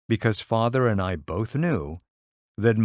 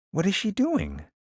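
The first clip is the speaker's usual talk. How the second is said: higher, fretful mother asking; a fussy mother querying, pitched up; mother's voice; 10 semitones higher than usual. Acted. Mother's voice, read aloud.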